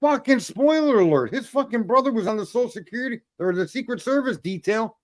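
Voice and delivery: high, exasperated outburst; fed up, fired up. Real, not acted. Host fed up with talking heads, fired-up exasperation. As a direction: burst out as if at the end of your patience. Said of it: "I can't stand it no more".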